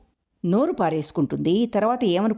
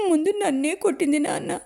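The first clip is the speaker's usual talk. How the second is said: put-on high child's voice, blurting it out after the blows; a scared little girl finally speaking up; small girl's voice; 10 semitones higher than usual. A narrator's reading. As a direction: daughter answering her father.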